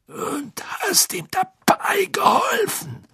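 raues Brummen im Flüsterton; brummend. Acted. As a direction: bissig nachhaken. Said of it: "brummte Moody". (Moody brummt höhnisch dazwischen)

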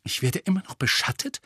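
indignant, high-pitched question; angry. A narrator's reading. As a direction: ask in affronted disbelief. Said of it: "fragte Harry zornig".

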